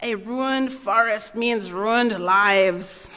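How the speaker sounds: usual speaking voice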